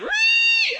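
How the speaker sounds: very high shouted whale squeal. Retold or imitated. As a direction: shriek like a whale call. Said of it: "the whale made a noise".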